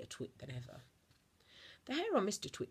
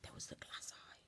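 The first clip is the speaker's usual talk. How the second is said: much softer breathy whisper; suspense whisper; 11 dB quieter than usual. A narrator's reading. Narrator teasing listeners about the eye, soft whisper. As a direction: drop to a hush for the reveal.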